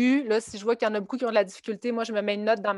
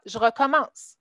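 ordinary talk, nothing marked

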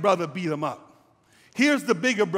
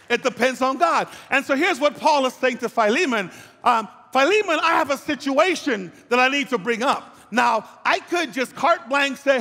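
raised, emphatic pulpit preaching; 6 semitones higher, 4 dB louder than usual. Acted. Pastor urging his congregation about genuine repentance, preaching. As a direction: drive the message home to the church.

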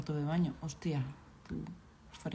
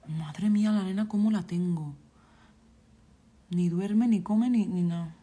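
loud lament; 7 dB louder than usual. Real, not acted. Player fretting aloud over the neglected baby, loud exasperated lament. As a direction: complain it out loud, louder throughout.

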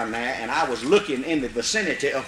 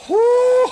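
whoop; 8 dB louder than usual. Retold or imitated. Coon seen up the tree.

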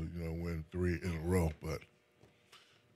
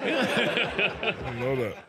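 high, loud and drawn out, laughing; real laughing disbelief; 11 semitones higher, 5 dB louder, much slower than usual. Real, not acted. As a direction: squeal it slowly through laughter. Laughing at being outdone.

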